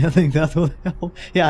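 laughing. Real, not acted. Laughing at own plan.

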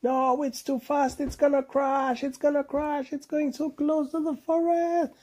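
very high, frantic crash cry; terrified animals. A narrator's reading. Animals in the falling rocket, very high and frantic. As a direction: shriek it as you fall. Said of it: "holding the chair".

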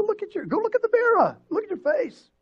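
high, loud pleading wife; pastor's wife, alarmed, pleads with him to see his own face; high exasperated wife. Retold or imitated. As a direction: make him look at himself, high and loud. Wife pleading he look at himself.

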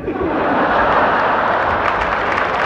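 airy high laugh at his own joke; high breathless chuckle; high breathy laugh. Real, not acted. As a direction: let out a light high laugh. Laugh after punchline.

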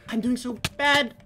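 high, whiny self-pity; exaggerated high-pitched whine; whining lament. Real, not acted. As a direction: moan in a high voice about doing badly. Wails at own gameplay.

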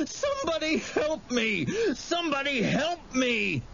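high-pitched terrified wail; sobbing cry for help. A narrator's reading. Wendell runs for the house, sobbing for help. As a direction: cry out in tearful panic. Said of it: "Wendell was sobbing as he ran".

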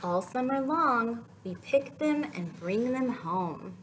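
slow, gentle storybook reading; picture book read aloud; reading to children. Acted. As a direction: read it like story time for toddlers.

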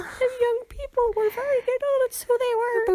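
high, wailing mock granny voice; squeaky mock old-lady lament; hammed-up old woman. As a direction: whine it like a fussy old auntie. Hamming up a phrase.

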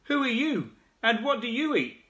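high calling shout from the frog. Acted. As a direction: call out brightly to a stranger. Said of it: "shouted the big wide-mouthed frog".

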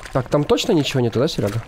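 ordinary delivery

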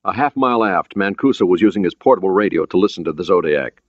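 ordinary delivery

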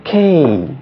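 K drawn out, starting high and falling; longer, emphasized K. Shown as a demonstration. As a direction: stretch the vowel in K with emphasis. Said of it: "Similarly as J".

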